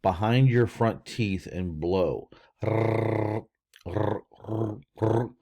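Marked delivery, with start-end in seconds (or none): 2.6-5.3 repeated tongue-tip trills; rolled R. Shown as a demonstration. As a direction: blow a trilled R in short bursts. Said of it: "put the tip of your tongue".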